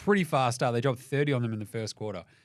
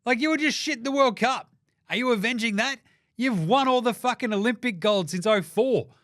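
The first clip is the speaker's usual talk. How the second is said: much higher, louder, incredulous; exasperated rant; 8 semitones higher, 4 dB louder than usual. Real, not acted. Host ranting at Team USA over their Avengers name, loud. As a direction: yell at the absurd name.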